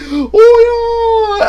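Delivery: high, loud mock crowd cheer; imitated fan cheering. Streamer imitating Japanese players at Hero's announcement, high cheering. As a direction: whoop like overjoyed fans, hammed-up.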